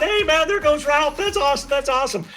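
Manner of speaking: high-pitched excited cheer for a buddy. Acted. Audience member cheering a friend on stage, excitedly cheering. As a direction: cheer like a proud friend in the crowd. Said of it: "you're cheering them on".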